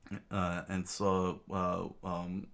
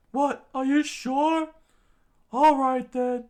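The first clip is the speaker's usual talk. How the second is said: very high, loud falsetto; high-pitched doubt, then agreement; startled sidekick; 10 dB louder, slower than usual. Acted. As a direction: question it loudly in a high voice.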